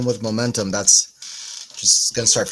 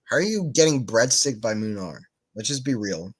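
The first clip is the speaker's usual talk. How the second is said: ordinary talk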